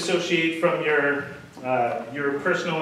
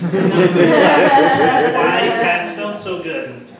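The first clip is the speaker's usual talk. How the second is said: laughing; 2 semitones higher, 9 dB louder, much slower than usual. Real, not acted. Laughing at his own cat remark.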